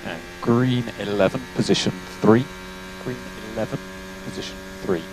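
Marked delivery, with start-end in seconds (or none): 3.0-5.0 softer, half-voiced repeat; quiet repeat. Real, not acted. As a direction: say it again under your breath. Engineer repeats call, quieter.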